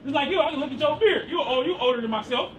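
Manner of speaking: usual speaking voice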